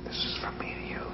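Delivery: unvoiced, secret whisper. Kid imagining the cricket speaks only to him, whispering. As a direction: whisper it like a secret just for you.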